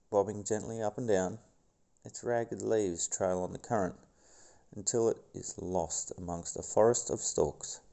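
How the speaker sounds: documentary narrator voice; nature documentary. Acted. Reads documentary script.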